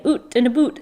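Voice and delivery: exaggerated Canadian "oot and a boot", vowels turned to oo; mock Canadian accent. Shown as a demonstration. Teasing their own Canadian speech, mock Canadian accent. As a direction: ham up the Canadian vowels, laughing.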